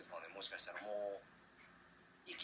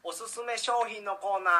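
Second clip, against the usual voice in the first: high, loud, drawn-out announcement; segment title call; 9 semitones higher, 10 dB louder than usual. Real, not acted. Radio host introducing the next corner, loud stretched title call.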